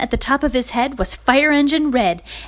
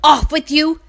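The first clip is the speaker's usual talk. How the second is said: high, loud shout; scream; 5 semitones higher, 5 dB louder than usual. A narrator's reading. Royal chasing off the hens, shouting. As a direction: send them off sharply. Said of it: "screamed Royal".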